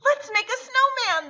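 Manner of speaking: put-on high, eager children's cry; excited children. A narrator's reading. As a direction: call out excitedly as kids. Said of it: "the children cried".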